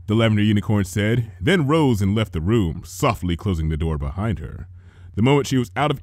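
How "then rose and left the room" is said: ordinary delivery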